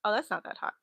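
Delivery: ordinary talk, nothing marked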